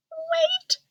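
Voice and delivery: tiny high squeak of a mouse; squeaky mouse voice. A narrator's reading. Mouse warning Ganesha, in a high squeak. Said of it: "squeaked Mister Mouse".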